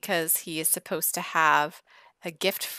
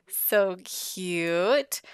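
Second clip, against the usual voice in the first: usual speaking voice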